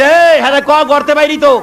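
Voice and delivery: put-on high, sharp scolding of a whining child; angry father. Father scolds his crying son at night, angry and high. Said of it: "বাবা তো রাগ হয়ে গেছে".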